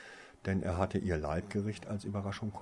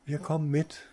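higher, louder eager reply; suddenly wide awake; 8 semitones higher, 6 dB louder than usual. A narrator's reading. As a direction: snap awake and jump in. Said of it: "erklärte Luna deutlich wacher".

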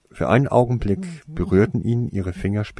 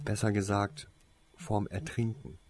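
soft, fading away at the end; quiet; 3 semitones higher, 7 dB quieter than usual. A narrator's reading. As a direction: add it in a hushed voice. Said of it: "erklärte Tase ihn leise". (leise nachgeschoben)

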